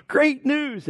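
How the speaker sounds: overdone mock joy; faked excitement. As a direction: fake being delighted. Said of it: "you kind of fake that".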